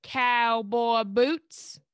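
very slow Southern twang, vowels stretched; prolonged-vowel twang. Shown as a demonstration. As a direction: copy a drawn-out Southern accent. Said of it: "prolonging, like, the vowels".

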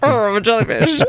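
giggling through the line; laughing. Real, not acted. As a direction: laugh as you say it. Repeats the jellyfish line laughing.

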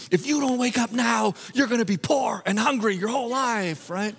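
ordinary delivery

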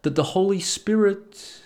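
slow, drawn-out mystic tone; a lightly voiced New Age guru; mock New Age guru. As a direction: lay on the spiritual guru act. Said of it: "Like every fucking New Age wanker".

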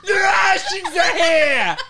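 high, loud, drawn-out Bronx screech; Italian Bronx woman. Acted. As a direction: scream like a hammed-up Italian Bronx mom. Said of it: "I could do that voice".

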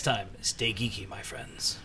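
soft, breathy farewell whisper; whispered sign-off. Acted. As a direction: breathe the goodbye out.

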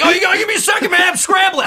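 high, loud flustered voice; frantic self-impression. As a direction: act rushed and panicked. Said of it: "It's like".

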